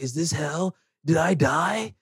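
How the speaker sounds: soft, hushed mock Edward; mock dazed. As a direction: murmur it like he's half gone. Edward thinks he has died.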